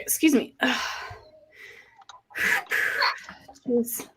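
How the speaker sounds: gagging from nausea; queasy retching between words; dry heaving. Real, not acted. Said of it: "Pregnant. Nauseous".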